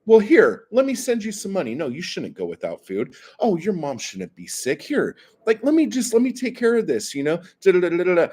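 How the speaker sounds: high, eager mock voice of a would-be hero; mock savior guy. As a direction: lay on the gushing generosity, mocking him. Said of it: "the dude having the fucking savior complex is like".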